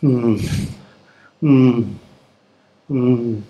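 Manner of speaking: short falling "hmm", repeated; brief closed-mouth groaning hums; convalescent's hum. Retold or imitated. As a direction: imitate patients' soothing moans. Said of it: "most common sound you hear".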